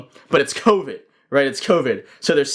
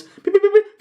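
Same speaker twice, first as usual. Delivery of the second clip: very high, loud electronic beeps; robot beeping; 5 dB louder than usual. Pretend. Bouncer bot scanning people for covid, high loud beeps. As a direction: beep like a scanning machine. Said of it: "Bot turns".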